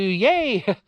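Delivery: high, drawn-out yay; acted-out happy hooray; happy cheer. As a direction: cheer it with the pitch jumping up. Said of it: "I mean, I'm happy".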